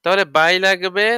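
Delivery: ordinary talk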